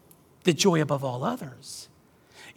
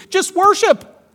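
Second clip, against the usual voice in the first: glib advice; 11 dB louder, slower than usual. Retold or imitated. Voicing glib advice from others.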